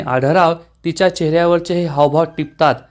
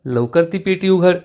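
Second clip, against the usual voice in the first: ordinary delivery, nothing marked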